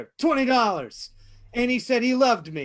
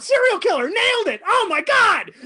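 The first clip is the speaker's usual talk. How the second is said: much higher and louder, triumphant crowing; gleeful shout; 10 semitones higher, 8 dB louder than usual. Acted. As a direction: crow with wild glee over the kill. Killer gloats after the murder.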